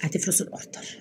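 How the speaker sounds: whispering. Real, not acted. Whispered prank plan.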